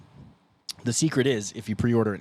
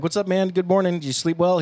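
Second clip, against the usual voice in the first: higher-pitched acted small talk; chirpy morning greeting; 8 semitones higher, 4 dB louder than usual. Bandmate acting out morning greeting to the driver, high chirpy small talk. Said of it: "You would just be like".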